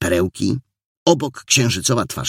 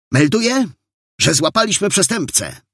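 put-on high-pitched eager boy reporting; excited report; 10 semitones higher than usual. A narrator's reading. Boy inspector reports a capture to the officer, excited. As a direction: announce it high and eagerly, like a young cadet.

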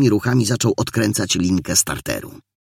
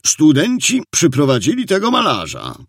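raised, formal reporting voice; official report; 7 semitones higher than usual. A narrator's reading. Sergeant tells the captain who was brought in, official tone. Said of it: "rzekł urzędowo".

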